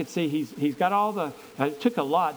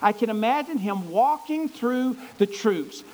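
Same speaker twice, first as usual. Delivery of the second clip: normal talking voice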